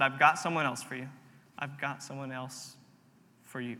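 low, soft quote, trailing to near silence; quiet retold voice. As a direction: quote God softly, letting it fade away. Retelling God's words to him.

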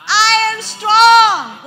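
very loud, high, stretched-out chant; bold declaration. Shown as a demonstration. Preacher leading the congregation to say it, loud and bold. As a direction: lead the room at full volume. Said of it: "Say it with me".

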